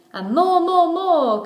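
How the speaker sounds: crowd roar. A narrator's reading. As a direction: swell it like a cheering crowd.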